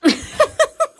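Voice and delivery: high burst of laughter; shrill laugh. Real, not acted. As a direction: cackle out loud, way up high. Laughs after insult from guest.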